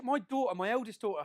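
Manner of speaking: ordinary delivery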